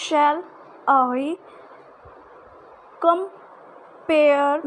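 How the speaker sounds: "Shall I compare" with "pare" stressed. Shown as a demonstration. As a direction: hit "compare" on its second syllable. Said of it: "जिस पे कि stress दे के बोला जाए".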